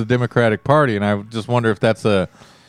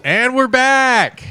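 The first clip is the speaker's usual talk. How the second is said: radio host; 12 semitones higher, much slower than usual. Acted. Radio host return line.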